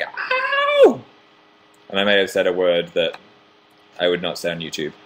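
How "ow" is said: sharp high yelp; mock yelp of pain. Retelling sitting on an earring, a mock yelp. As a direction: yelp like it just stabbed you. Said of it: "It was more like".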